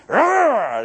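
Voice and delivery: sudden loud, high mock roar; shark roar. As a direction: snap like a shark clamping down. Shark clamping down in business.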